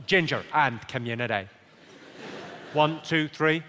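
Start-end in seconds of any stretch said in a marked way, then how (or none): 0.1-1.5 lower-pitched Belfast model line; broad Northern Irish vowels; Belfast accent. Shown as a demonstration. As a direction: lead the crowd in Belfast accent. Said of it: "Let's go Belfast".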